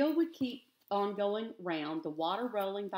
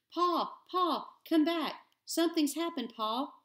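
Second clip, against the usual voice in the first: Miles calling out; 8 semitones higher than usual. A narrator's reading. Son calling his father back across the pond, high urgent shout. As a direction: yell for Pa from far off, urgent. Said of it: "a voice rang out".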